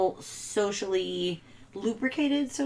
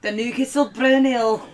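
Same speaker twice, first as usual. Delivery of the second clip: loud, higher Scottish accent; 5 semitones higher, 7 dB louder than usual. Shown as a demonstration. Wife recalls reading the beer bottle to his dad, Scottish accent. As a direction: put on a big Scottish brogue. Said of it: "in a Scottish accent".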